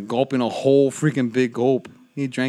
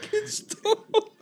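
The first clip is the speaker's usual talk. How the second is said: high wheezing laugh while talking; gasping the words out through laughter; 3 dB quieter, much slower than usual. Real, not acted. Crying with laughter.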